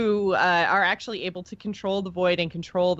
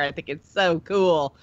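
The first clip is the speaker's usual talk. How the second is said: ordinary talk, nothing marked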